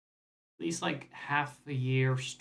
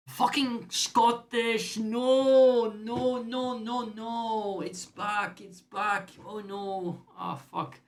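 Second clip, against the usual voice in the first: much higher, loud panicked Scottish; Scottish slipping in; 10 semitones higher, 6 dB louder, much slower than usual. Real, not acted. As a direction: yelp as the accent takes over. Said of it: "His Scottish is too authentic".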